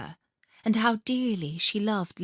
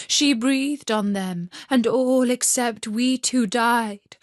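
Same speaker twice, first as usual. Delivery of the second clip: wood pigeon voice; 4 semitones higher, 3 dB louder than usual. Acted. Pigeons tell Gerda about Kay, high bird voice.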